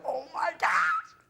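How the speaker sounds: high-pitched laughing squeal; excited squeal. Retold or imitated. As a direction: starstruck fanboy shriek. Meeting his YouTube idol.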